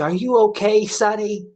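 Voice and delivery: a hint of a kindly old lady leaning in with concern; little old lady voice. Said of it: "this little old lady".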